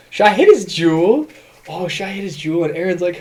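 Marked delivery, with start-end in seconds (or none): 0.1-1.3 put-on high, loud, giggly drunk question; tipsy girl voice. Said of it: "she was like". 1.7-2.7 a hint of a tipsy girl, closer to normal; tipsy girl voice, toned down. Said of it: "she was like".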